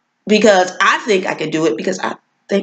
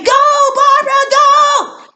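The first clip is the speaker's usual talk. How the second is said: loud high cheer from far off; mom yelling; 4 dB louder than usual. Retold or imitated. Mom cheering daughter from the bleachers, loud high yell. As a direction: yell it across the field like a proud mom. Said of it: "I can hear her yelling my name".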